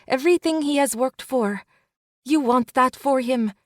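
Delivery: best friend stopping Ruby from going back; urgent friend. Acted. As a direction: hold her back with pleading urgency. Elena reasons with Ruby.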